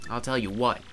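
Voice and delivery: ordinary delivery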